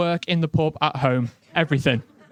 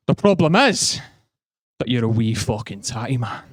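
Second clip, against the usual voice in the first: put-on Glaswegian accent; 5 semitones lower than usual. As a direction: hammer the insult home in broad Glasgow. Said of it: "he goes up to a mate and goes".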